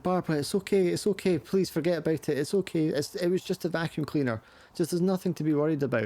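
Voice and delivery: high, hurried reassurance; comforting mother. Acted. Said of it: "trying to comfort her".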